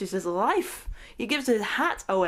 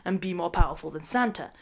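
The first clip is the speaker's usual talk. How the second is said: ordinary talk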